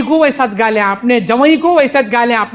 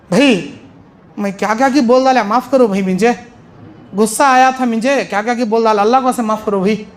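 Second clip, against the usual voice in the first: remorseful plea; 5 semitones lower than usual. Retold or imitated. Remorseful man begging forgiveness.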